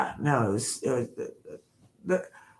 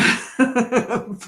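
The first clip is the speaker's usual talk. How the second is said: high giggle; 8 semitones higher, 4 dB louder than usual. Real, not acted. Laughing at herself.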